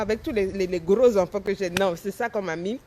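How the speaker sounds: ordinary talk, nothing marked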